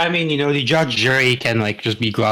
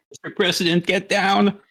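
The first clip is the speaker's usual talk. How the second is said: bodyguard shout; 9 semitones higher than usual. Pretend. Mock bodyguard callout.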